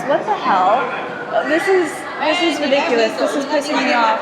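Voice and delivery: loud, exasperated shout at the bare enclosure; angry outburst. Real, not acted. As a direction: blow up at how awful it is. Zoo frustration boils over.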